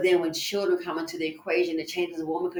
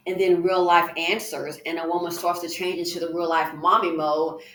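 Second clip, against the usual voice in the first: usual speaking voice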